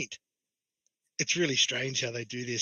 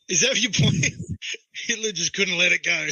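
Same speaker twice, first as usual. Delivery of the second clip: laughing; 6 semitones higher, 5 dB louder than usual. Real, not acted. Laughing while mocking.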